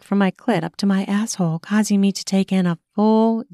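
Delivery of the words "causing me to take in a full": ordinary delivery, nothing marked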